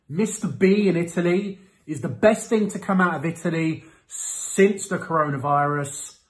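normal talking voice

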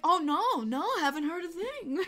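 high, sing-song mock innocence; badly faked innocent denial, voice lifted; feigned innocence. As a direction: play innocent, barely holding back a laugh. Retells playing innocent to the usher.

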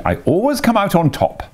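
put-on high fussy old washerwoman. A narrator's reading. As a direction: play Toad disguised as a chattering washerwoman. Toad gloats.

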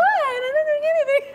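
very high-pitched innocent protest; retold protest. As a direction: squeak your denial, acted out.

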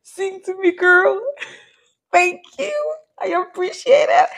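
very high, squeaky voice, overcome with delight; high-pitched gushing praise; overwhelmed squeal. Real, not acted. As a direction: squeal your thanks to the singer. Overcome reacting to the singer.

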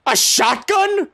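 slow, high amazed question; drawn out in disbelief; real wonder at the find; surprised. Real, not acted. Finds a weapon, surprised.

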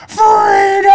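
high-pitched retold scream of a movie line; loud battle cry. Speaker with blue face surprising the crowd, imitated loud cry. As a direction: mimic a speaker yelling out a war cry. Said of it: "I mean, just really loud".

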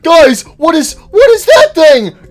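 high, loud alarmed; high, loud startled outburst; shocked. Real, not acted. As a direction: gasp it out in shock. Shocked at the screen.

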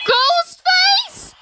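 high, loud shriek of a name; piercing startled shriek; frightened scream. Real, not acted. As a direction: scream it out in alarm. Killer revealed, screams its name.

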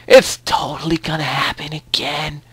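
slow, drawn-out stage whisper; mock-ominous whisper. Gamer contradicting his own vow, mock-ominous whisper. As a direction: whisper it slowly, like a confession.

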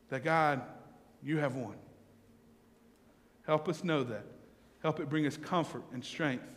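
hushed, reverent address to God; closing prayer. Real, not acted. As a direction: pray it quietly, settling down toward the amen.

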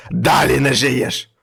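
slow, loud accusation; devil shouting. Acted. Devil catching the man, shouting slowly and loud. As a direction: drag out each word in fury.

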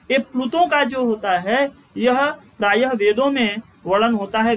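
usual speaking voice

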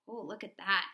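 ordinary talk, nothing marked

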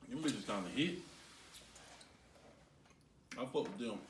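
words muffled by chewing; talking while chewing. Real, not acted. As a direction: mouth partly full of candy. Seen 3: Two tasters chew sour candy; unclear who speaks, both mouths moving.

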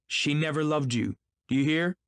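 high, vehement outburst; excited cry. A narrator's reading. Gatsby confronting the husband, crying out excitedly. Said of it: "he cried".

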